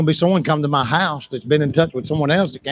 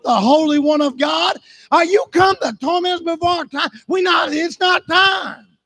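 high-pitched, sustained pulpit shout; full-force preaching; 11 semitones higher than usual. Acted. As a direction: preach it hard, crying out as the demons.

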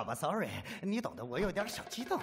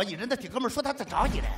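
very fast talking; 3 semitones higher, faster than usual. Real, not acted. Rushes out the punchline.

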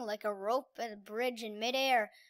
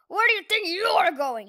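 louder, higher menacing roar; 5 semitones higher, 6 dB louder than usual. Acted. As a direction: roar at the escaping girl. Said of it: "he roared".

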